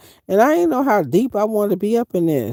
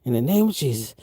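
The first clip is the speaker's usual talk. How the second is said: lightly voiced muttered plea to the Lord; a soft low plea to Jesus; praying for help; 4 semitones lower, 4 dB quieter than usual. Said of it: "I was like".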